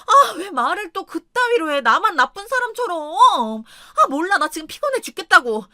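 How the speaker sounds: tipsy wife lashing out at her husband; whiny angry wife. Retold or imitated. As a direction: snap back like a spoiled wife. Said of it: "또 화를 내는 거예요".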